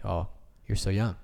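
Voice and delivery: usual speaking voice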